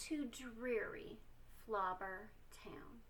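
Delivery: hushed, unvoiced whisper of the dreaded name; spooky whisper. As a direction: whisper it like a scary secret.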